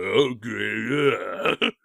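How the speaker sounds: low gravelly Stallone mumble. Retold or imitated. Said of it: "normal gravelly voice".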